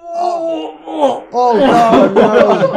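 high cry of horror, then laughing; horrified outcry. Real, not acted. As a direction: wail it out in mock horror. Horror at becoming Trumps.

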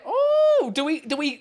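usual speaking voice

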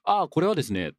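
formal explanatory tone. Acted. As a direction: recite a mock-official explanation of the shirt. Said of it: "必ずこの口調でお願いします".

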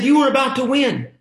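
high, loud protest; exasperated shout. Retold or imitated. Dad to son who gave up winning, high loud shout. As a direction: yell it in pained disbelief.

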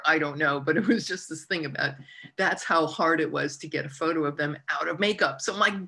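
normal talking voice

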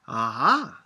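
a sudden bright perk-up. A narrator's reading. Grandmother perking up at the question, bright and loud. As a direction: snap awake with interest. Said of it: "perking up".

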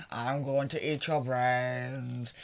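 low, slow groaning zombie. Pretend. Mimicking a brain-hungry zombie for a friend, slow and low. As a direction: drag it out like a shambling undead. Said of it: "stereotypical zombie with its arms hanging out".

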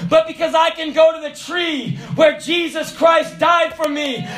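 preaching. Acted. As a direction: proclaim it to the congregation. Preaching at full force.

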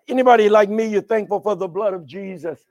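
ordinary delivery

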